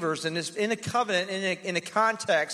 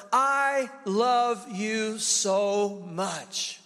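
tender declaration; much slower than usual. Retold or imitated. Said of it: "he just says to her".

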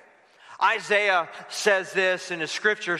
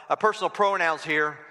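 normal talking voice

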